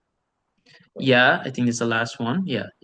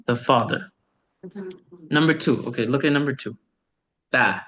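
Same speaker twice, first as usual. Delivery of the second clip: ordinary talk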